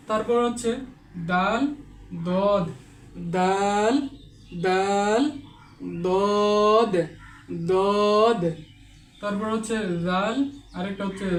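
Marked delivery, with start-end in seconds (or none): 1.3-8.4 teacher contrasts দাল and দদ; letter contrast demo. Shown as a demonstration. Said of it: "এভাবে উচ্চারণ করতে হবে". (দাল ও দদ পার্থক্য)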